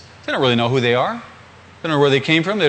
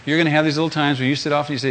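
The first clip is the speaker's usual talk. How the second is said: ordinary talk, nothing marked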